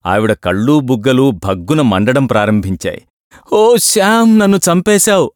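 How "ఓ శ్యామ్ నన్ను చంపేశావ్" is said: wailing lament. A narrator's reading. Landlady with burning eyes wailing at the lodger. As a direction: howl that he has killed her. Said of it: "అని గొల్లుమంది".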